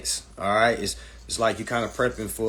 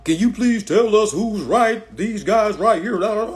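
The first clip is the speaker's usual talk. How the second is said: higher, louder, slow whiny mimic of the tagger; mocking quote; 9 semitones higher, 6 dB louder than usual. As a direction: drag it out like a needy plea for a verdict. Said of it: "like we ain't solid over here".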